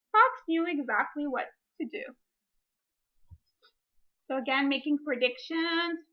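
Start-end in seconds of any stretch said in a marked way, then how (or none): 0.1-2.0 put-on reading a picture book aloud to children; story reading. Teacher reading a picture book to her class, storytime voice.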